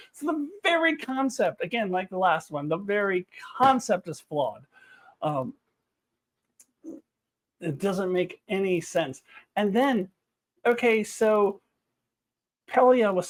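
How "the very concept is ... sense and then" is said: ordinary talk, nothing marked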